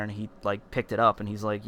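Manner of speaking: usual speaking voice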